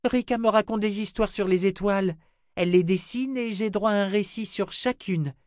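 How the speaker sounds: put-on high-pitched little girl voice; little girl Rachel. Little girl urges the old wizard to eat, high child voice.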